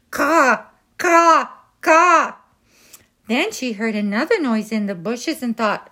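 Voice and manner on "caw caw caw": loud, high caws like a crow; crow cawing. Acted. Mother crow calling as her children fly away, loud caws.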